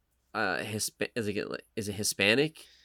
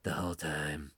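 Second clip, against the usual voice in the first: brooding hero parody; 6 semitones lower, 11 dB quieter than usual. Retold or imitated. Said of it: "Kinda sounded like The Witcher".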